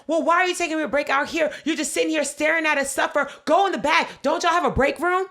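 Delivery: two customers ganging up on the clerk, yelling; angry yelling. Retold or imitated. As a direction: yell it at the clerk together. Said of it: "they're like".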